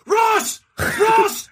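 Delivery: very high, loud repeated shout; imitated full-lunged yelling. Man yelling after the escaping cosplayer, imitated high shouting. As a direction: holler the name after someone fleeing. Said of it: "yelling at the top of his lungs".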